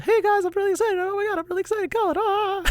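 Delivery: very high, loud bubbly character voice; hyper-excited character. Acted. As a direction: play a character bursting with excitement. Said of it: "that character will show up in the series".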